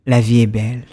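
low, smooth suave voice; sultry narrator. Shown as a demonstration. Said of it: "je vais prendre ma voix suave".

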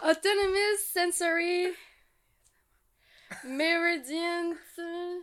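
high, loud, drawn-out recitation; slow effortful recall. Real, not acted. Guest quizzed on what ASMR means, slow halting recitation. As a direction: stretch each word while searching for the next.

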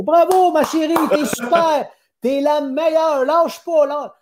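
hugely high, forced cheering; bad acting. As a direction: cheer her on with overdone fake enthusiasm. Cheering daughter while she plays badly.

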